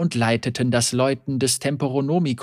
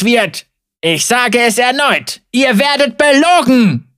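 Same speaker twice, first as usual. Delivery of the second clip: loud preaching; 10 semitones higher, 9 dB louder than usual. Acted. Heretic rebuts, preaching loudly.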